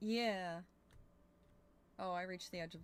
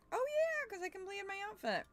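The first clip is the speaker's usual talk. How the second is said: high, bright delighted squeal; excited; 13 semitones higher, 3 dB louder, slower than usual. Real, not acted. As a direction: gush over a new reward. Delighted by completed outfit.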